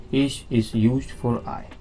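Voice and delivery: ordinary talk, nothing marked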